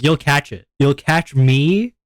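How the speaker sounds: ordinary talk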